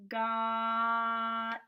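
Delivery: "Got" with a long held vowel and a quick t; sounded out slowly. Shown as a demonstration. Teacher taking her turn first, "Got" sounded out slowly. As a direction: stretch "Got" on one level note. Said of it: "This is a fast sound".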